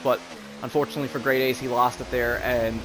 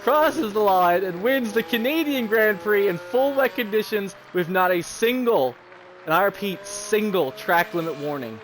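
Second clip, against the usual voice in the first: race commentary; 5 semitones higher than usual. Acted. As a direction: draw out the winning moment. Commentator calling the win.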